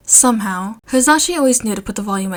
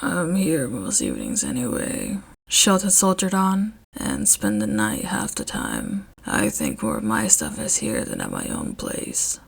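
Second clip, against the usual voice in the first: much lower and softer, embarrassed; very low, soft grumble; Shota's deep quiet voice; 11 semitones lower, 6 dB quieter than usual. A narrator's reading. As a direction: mumble it, a little ashamed.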